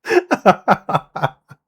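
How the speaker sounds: high, loud real giggle. Real, not acted. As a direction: laugh it out at the phrase. Laughing at the phrase.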